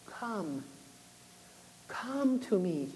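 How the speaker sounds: hushed and slow, drawing her near; almost a breath, an invitation; soft call. Retold or imitated. God quietly calling her to come to him, softly. God's gentle call retold.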